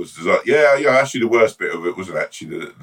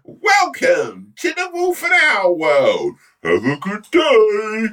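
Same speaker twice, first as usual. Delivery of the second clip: park greeter; much slower than usual. Pretend. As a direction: call out like a cheery park host. Theme park greeter announcement.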